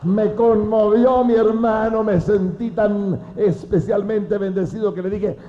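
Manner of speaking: loud, high, slow preaching cry; fervent preaching. Acted. Preacher testifying to his brothers, loud fervent cry. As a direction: proclaim it to the congregation.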